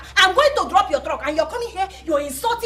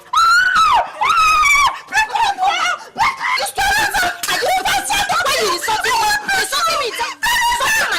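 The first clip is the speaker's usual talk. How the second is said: very high, loud laughing screams; shrieking fight, half laughing; hysterical shriek; 6 dB louder than usual. Acted. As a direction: scream in mocking outrage.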